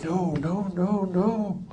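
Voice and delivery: frantic under-the-breath warning; lightly voiced lawyer trying to stop his client; hushed no. Lawyer urgently signals from his seat.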